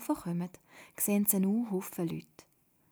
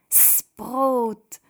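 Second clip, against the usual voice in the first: "Das Brot" loud and higher, drawn out; called-out correction; 5 semitones higher, 10 dB louder than usual. A narrator's reading. Children correcting Toda, calling out "Das Brot" loudly. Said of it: "rufen die Kinder miteinander".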